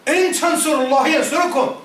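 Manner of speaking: rapid chanted Arabic verse; تلاوة سريعة; Quran recitation. Real, not acted. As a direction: recite it quickly in one breath.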